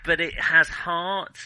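ordinary talk

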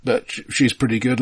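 ordinary delivery

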